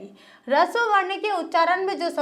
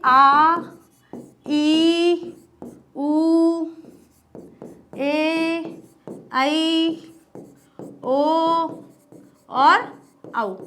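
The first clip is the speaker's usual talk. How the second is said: drawn-out long vowels; much slower than usual. Shown as a demonstration. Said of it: "अब आते हैं दीर्घ वर्ण".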